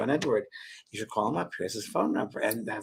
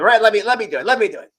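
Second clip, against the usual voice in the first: louder, higher, pushy; mock eager Oprah; 8 semitones higher, 10 dB louder, faster than usual. Imitating Oprah taking over, loud mock eagerness. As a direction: barge in eagerly. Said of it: "She goes".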